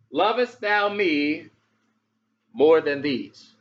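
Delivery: slow, weighty King James ask; slow solemn question. Acted. Jesus asking Peter the third time, slow and solemn. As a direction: let each word land slowly. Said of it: "he asked the third time".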